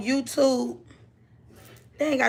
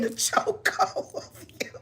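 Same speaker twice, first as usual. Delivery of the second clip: whisper; 3 semitones higher, 8 dB quieter than usual. Real, not acted. Joking she'd be choked.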